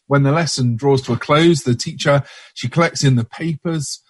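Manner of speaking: ordinary delivery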